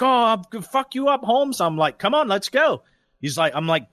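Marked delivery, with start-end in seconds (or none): 0.0-1.6 high-pitched mock threat; upset threat. Other driver threatening a fist fight, upset mock threat. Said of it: "he starts getting all upset". 2.0-2.8 higher-pitched mock challenge; fight challenge. Driver daring the other man to fight, mock challenge.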